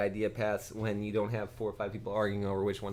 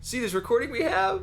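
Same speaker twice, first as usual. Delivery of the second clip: much higher, louder put-on Hutt voice; slightly wrong copied voice; 8 dB louder than usual. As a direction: hold up the fake evidence gleefully. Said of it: "mimic the Hutt who's dead's voice".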